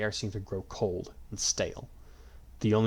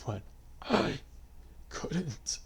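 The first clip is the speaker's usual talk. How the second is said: faint dying whisper; 5 semitones higher, 6 dB quieter, much slower than usual. A narrator's reading. Dying last request.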